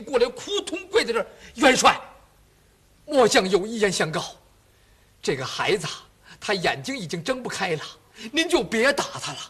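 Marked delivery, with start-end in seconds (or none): 1.6-9.4 put-on loud, urgent plea from a kneeling old general; pleading old general. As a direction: beg the marshal to beat you instead of your son. 老将跪下求元帅代子受刑. (老将跪地高声求情)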